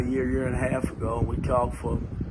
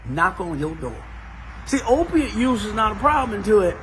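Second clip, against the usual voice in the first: climbing, punchy preacher build; rising sermon preaching; 7 semitones higher than usual. Acted. Pastor warning the church about addiction, building preaching. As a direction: preach it out to the congregation.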